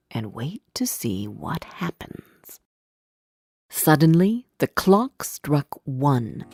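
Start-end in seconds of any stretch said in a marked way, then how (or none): none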